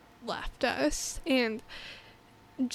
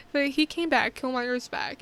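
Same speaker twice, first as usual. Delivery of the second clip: ordinary talk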